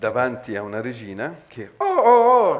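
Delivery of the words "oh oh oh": high shrieking oh oh oh, loud; shrieking queen. A narrator's reading. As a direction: cry out like a queen in pain. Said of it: "gridava la regina".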